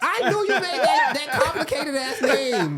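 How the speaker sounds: high, loud, laughing exclamation; real hyped-up ribbing; teasing outburst. Real, not acted. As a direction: crow it through laughter, drawing out the end. Host teases guest about name.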